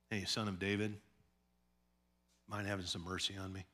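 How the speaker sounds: quiet, quick offhand request; casual, polite ask. Retold or imitated. Beggar asking Jesus casually, soft and quick. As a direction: ask it lightly, no urgency. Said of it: "He didn't walk up and go".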